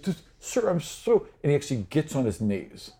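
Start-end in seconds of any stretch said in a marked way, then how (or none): 0.0-1.3 high, pleading apology in a much higher voice; cowering servant. Acted. Game master voicing the beaten servant, high and pleading. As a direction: beg forgiveness, voice pitched way up. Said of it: "He's like".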